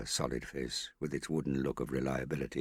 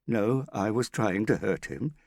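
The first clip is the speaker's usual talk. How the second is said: louder, firm contradiction; forceful denial; 7 semitones higher, 6 dB louder than usual. Acted. Father contradicting Hester, firm and louder. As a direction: insist on the guilt.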